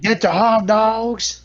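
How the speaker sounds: high, slow New York vendor call; New York hot dog vendor. Pretend. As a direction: stretch it out like a stadium hawker. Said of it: "And then we got the new guy".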